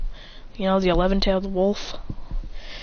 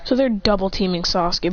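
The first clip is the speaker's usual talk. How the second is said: ordinary delivery, nothing marked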